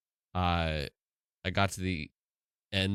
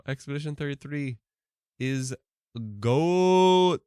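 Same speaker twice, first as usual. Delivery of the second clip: put-on loud, high Brazilian-accented cheer on goat; 6 semitones higher, 6 dB louder, much slower than usual. Reading a fan's praise aloud in a loud Brazilian accent. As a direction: shout the last words like an excited Brazilian fan. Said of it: "Why am I adding an accent?".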